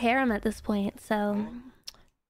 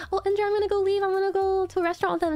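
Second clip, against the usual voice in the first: chirpy goodbye; 7 semitones higher, much faster than usual. Retold or imitated. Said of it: "I was like".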